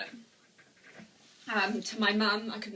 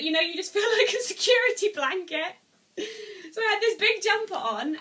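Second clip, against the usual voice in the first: higher, louder, half laughing, half crying; real emotional laugh-cry about a comfy jumper; laughing through tears; 10 semitones higher, 7 dB louder than usual. Real, not acted. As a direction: let the laugh break with tears as you remember. Tearful memory, laughing and crying.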